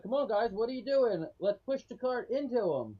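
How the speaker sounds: rally the others to push with you; urging companions. Acted.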